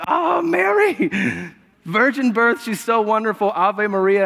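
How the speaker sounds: mock adoring Christmas gush; gushing praise. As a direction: churchgoers fawning over Mary at Christmas. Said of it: "we're like".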